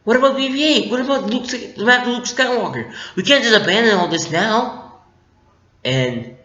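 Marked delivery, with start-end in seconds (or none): 0.0-5.0 Rey pleading. Retold or imitated. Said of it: "Rey's just like".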